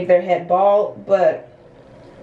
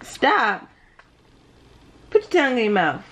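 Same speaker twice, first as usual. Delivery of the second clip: ordinary talk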